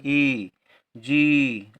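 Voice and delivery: "ई" and "जी" with the ee held long; long vowel. Shown as a demonstration. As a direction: stretch out the vowel in "ई, जी". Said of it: "और देर तक हो रहा है". ("ई, जी" में ई को देर तक खींचकर)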